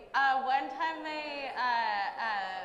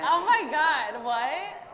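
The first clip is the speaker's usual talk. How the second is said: amazed; 3 semitones higher, 9 dB louder, slower than usual. Real, not acted. Amazed by the impression.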